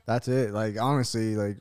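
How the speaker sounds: ordinary delivery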